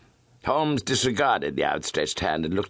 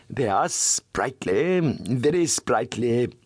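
slow, silky and amused; smooth suave voice; 7 semitones higher, slower than usual. Acted. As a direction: savour each word, slowly and slyly. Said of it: "His voice was as smooth and suave".

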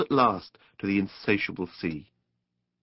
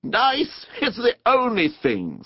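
high, slow, emphatic conviction; solemn; 12 semitones higher, 3 dB louder, slower than usual. A narrator's reading. Rat tells Mole about boating, solemnly. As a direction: declare it with great earnestness. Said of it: "said the water rat solemnly".

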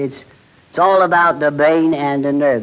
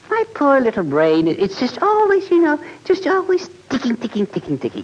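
whiny complaint; 9 semitones higher than usual. Acted. Comic whining about overworked brain.

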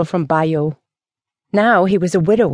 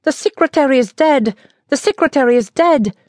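panicked scream, pitched high; terrified cry for the whole street; 8 semitones higher than usual. A narrator's reading. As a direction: shriek the news in panic.

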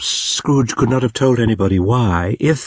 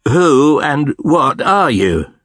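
louder, higher, slow demand; 6 semitones higher, 4 dB louder, slower than usual. A narrator's reading. Scrooge demands to know what the ghost is, loud and slow. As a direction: insist on an answer, loud and slow. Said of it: "Scrooge demanded".

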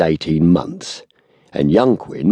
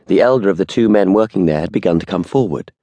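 usual speaking voice

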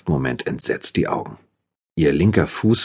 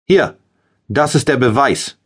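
much higher, loud, gloating yell; triumphant shout; 9 semitones higher, 4 dB louder than usual. A narrator's reading. Mustafa holds up the bloody proof, a high, loud, gloating shout. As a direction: crow it out like a victor holding up the trophy. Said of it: "hörte ich diesen Mustafa schreien".

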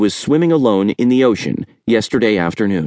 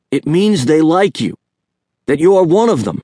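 higher, knowing uncle's voice; Uncle Jerry, higher voiced; 7 semitones higher, 3 dB louder than usual. A narrator's reading. Uncle explaining friendship, higher knowing voice. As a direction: let the nephew in on it, pitched up. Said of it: "Uncle Jerry said".

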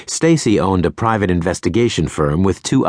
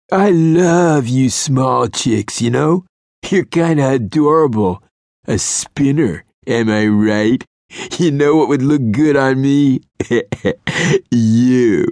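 sleazy flirt voice; 7 semitones higher than usual. A narrator's reading. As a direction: purr it like a cheesy lounge lizard.

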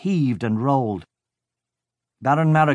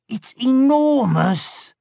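much higher, very slow awestruck voice; awed henchman; 14 semitones higher, 3 dB louder, much slower than usual. A narrator's reading. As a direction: gasp it out in amazement. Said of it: "Blood said".

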